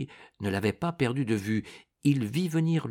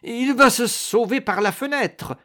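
high, loud warning shout; alarmed cry; 12 semitones higher, 5 dB louder than usual. A narrator's reading. The monk warns allies the enemy may escape, alarmed shout. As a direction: sound the alarm, pitched right up.

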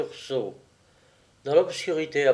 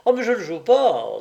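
quick, higher-pitched protest; exclaiming; 6 semitones higher, 4 dB louder, faster than usual. A narrator's reading. Painter refuses the cards he is dealt, exclaiming. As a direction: exclaim it in one fast burst. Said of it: "s'exclama le peintre".

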